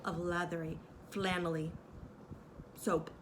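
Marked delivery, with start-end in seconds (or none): none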